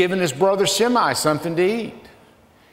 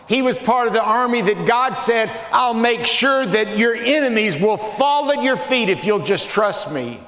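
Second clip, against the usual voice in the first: fervent preaching; 6 semitones higher than usual. Real, not acted. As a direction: preach it to the rafters. Preaching at full force.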